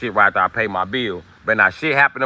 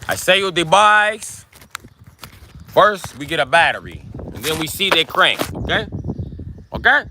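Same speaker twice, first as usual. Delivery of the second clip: higher-pitched mock Mexican accent, selling the bikes; imitating a Mexican accent, pitched up; 6 semitones higher than usual. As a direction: play a Mexican bike seller in a hammed-up Mexican accent. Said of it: "Maybe I like the Cuban accent better than the Mexican accent".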